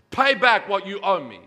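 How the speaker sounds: loud, harsh demand for payment. Acted. Servant choking his fellow servant, loud harsh demand. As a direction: bark the order at the debtor. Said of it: "he demanded".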